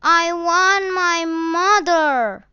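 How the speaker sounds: high, loud wailing sob; lost boy wailing for his parents; crying child. A narrator's reading. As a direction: cry it out through tears.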